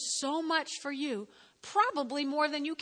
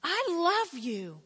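God's loving voice; 5 semitones higher, 5 dB louder, slower than usual. Acted. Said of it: "God's going".

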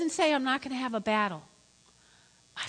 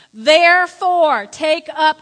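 "Therefore" slow and loud; emphatic scripture reading; 11 dB louder, slower than usual. Real, not acted. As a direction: hammer out "Therefore, take up" to the congregation. Emphatic scripture reading after "Ready?.

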